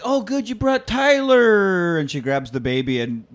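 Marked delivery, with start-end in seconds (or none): 0.1-2.0 higher, slow, sweet mock woman's voice; delighted woman. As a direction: coo it up high and slow. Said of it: "she's like".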